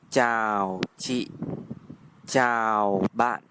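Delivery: ordinary talk, nothing marked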